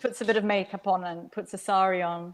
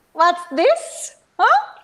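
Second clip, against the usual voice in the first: high, sweet-talking coo; honeyed auntie; 9 semitones higher, 8 dB louder, much slower than usual. A narrator's reading. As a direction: turn suddenly sweet and syrupy. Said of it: "the honey seeping back into her voice".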